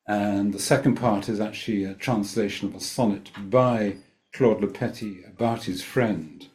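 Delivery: ordinary delivery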